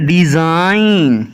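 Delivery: डिजाइन drawn out slowly with no G; silent G. Shown as a demonstration. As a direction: model डिजाइन slowly, going straight to the N. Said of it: "इसको क्या पढ़ना है".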